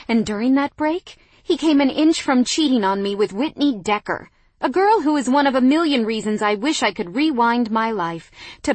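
furious demand. A narrator's reading. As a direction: pour out the hurt in a furious tirade. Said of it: "I demanded furiously".

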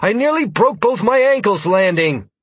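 high, loud angry scolding; angry complaint. Acted. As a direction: complain angrily about the landing. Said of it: "limped across the room angrily".

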